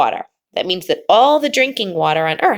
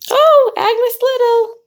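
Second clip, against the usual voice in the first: high cooing call; 5 dB louder than usual. Real, not acted. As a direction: coo her name way up high. Cooing at a child.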